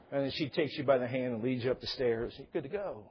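ordinary talk, nothing marked

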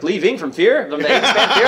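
high, loud incredulous question; excited mock disbelief; imitated amazed driver. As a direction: ask it like an astonished fan. Retold amazed cab driver.

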